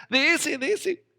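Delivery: high, loud pointing whine; mock insistent whiner; insistent complaint. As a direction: insist impatiently, hamming it.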